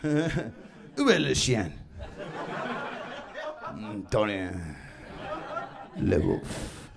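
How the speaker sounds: low, slow mock-French dog voice; arrogant French dog. Posey dog in Paris, low arrogant French voice. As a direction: drop low and languid, posing in French. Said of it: "Even French dogs have posy arrogance".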